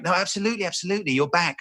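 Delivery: high, obliging producer voice; BBC producer. Retold or imitated. Producer agreeing to a callback, eagerly obliging. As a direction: imitate an over-accommodating caller. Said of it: "He's like".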